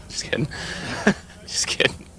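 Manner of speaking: breathy laugh through words. Real, not acted. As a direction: giggle out a quick retraction. Host laughs off his joke.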